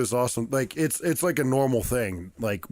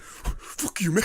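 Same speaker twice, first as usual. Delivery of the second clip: mock angry; 7 semitones higher, much slower than usual. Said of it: "the guy, like, got red in his face".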